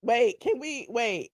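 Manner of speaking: ordinary talk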